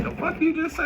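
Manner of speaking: high incredulous "the fuck you just say"; shocked retort. Retold or imitated. Retelling his shocked reply to a nosy person. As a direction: recoil in disbelief. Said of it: "I'm like".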